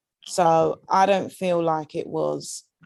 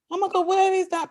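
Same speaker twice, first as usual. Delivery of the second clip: high, mock-alarmed gasp of a question; mock surprise; 11 semitones higher than usual. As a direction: pretend to miss them, pitched way up. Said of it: "and I wouldn't go".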